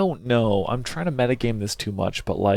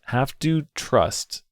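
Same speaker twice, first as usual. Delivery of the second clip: slow, deliberate repeat; 3 semitones higher, much slower than usual. Shown as a demonstration. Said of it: "That's how I am".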